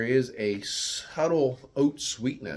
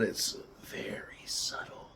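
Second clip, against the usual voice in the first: soft slow whisper; 10 dB quieter, slower than usual. Real, not acted. Beer reviewer confiding a subtle flavor to viewers, whispered. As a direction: breathe it like a secret.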